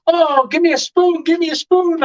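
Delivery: put-on like a kid in a candy shop; retold eager rush to taste. Dad eager to taste sauce.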